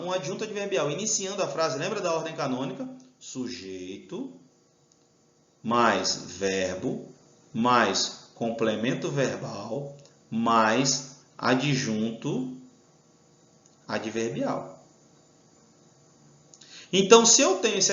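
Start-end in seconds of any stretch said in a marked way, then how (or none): 3.2-14.8 very slow and low, item by item; slow dictation. Shown as a demonstration. Teacher lists canonical sentence order for students, dictating slowly. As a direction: lay out each term slowly, pausing between them.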